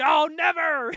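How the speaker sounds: loud, high theatrical cry; mock defiant shout. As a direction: yell it out like a hammed-up hero. Janice's defiant cry, retold.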